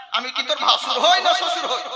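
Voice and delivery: ordinary talk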